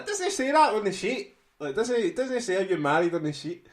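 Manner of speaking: high, loud, protesting; mock indignation. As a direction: protest that it's not on the form. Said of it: "and I was like".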